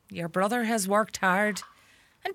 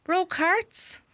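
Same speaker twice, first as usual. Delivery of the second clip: mock disbelief; 10 semitones higher than usual. Wife mocks Tom's boast.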